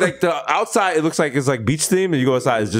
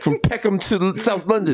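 higher-pitched London roadman parody; mock London accent; 4 semitones higher than usual. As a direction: demand it in put-on London street slang. Spoofing British Top Boy John Henry.

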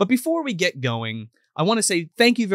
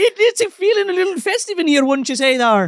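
mock Irish brogue, much higher; Irish accent; 10 semitones higher, 4 dB louder than usual. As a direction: ham up an Irish host voice. Festive Irish bit continues.

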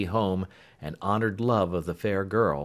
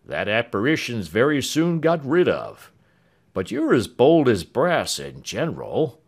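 lightly voiced, unruffled manservant; a hint of a dry, calm husband; composed Britain; 4 semitones higher, 6 dB louder than usual. A narrator's reading. Britain teasing Clemency about the lantern, lightly voiced and unruffled.

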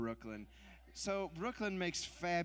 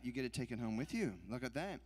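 ordinary talk, nothing marked

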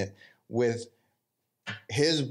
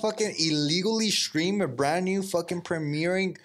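mock outrage; 7 semitones higher than usual. Friend suggests streaming the movie, hammed-up mock outrage. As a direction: act scandalised, pitch jumped up.

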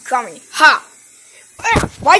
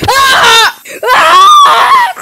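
very high, loud shriek; piercing horror scream; terrified scream; 10 semitones higher, 8 dB louder than usual. Acted. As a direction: scream for your life. Attacked, screaming.

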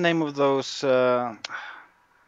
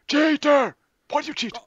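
very high, squealed accusation; mock outrage; 5 dB louder than usual. Fighter accusing rival of cheating, squealed mock outrage. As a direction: wail the accusation, pitched right up.

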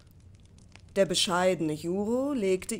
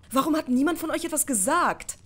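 higher-pitched, reproachful question; accusing, pitched up; 6 semitones higher, faster than usual. A narrator's reading. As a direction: put the blame into the question. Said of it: "fragte Juro vorwurfsvoll".

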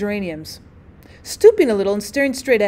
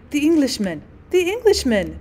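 child's frightened cry, voiced higher; a little girl crying out in fright, understated; lightly voiced scared shriek; mild shriek; 4 semitones higher than usual. A narrator's reading. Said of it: "she shrieked".

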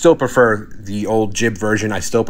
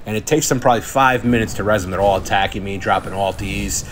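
normal talking voice

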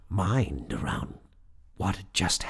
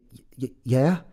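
higher, startled stutter; stammering; 6 semitones higher, 4 dB louder than usual. A narrator's reading. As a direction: stumble over the word, voice lifting into a question. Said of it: "I stammered".

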